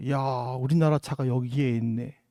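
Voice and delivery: low, soft murmur of admiration; hushed, low-pitched awe; moved wonder. Retold or imitated. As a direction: marvel quietly, as if to yourself. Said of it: "감동이 있는지 몰라요".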